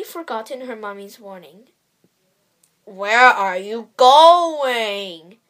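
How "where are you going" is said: slow, loud growl of a wolf. Acted. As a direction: growl it slowly and loudly. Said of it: "growled the wolf".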